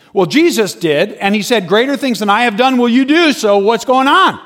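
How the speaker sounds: re-enacted impassioned comeback; challenging rebuttal. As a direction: press the argument home before the crowd. Teller argues scripture at preacher.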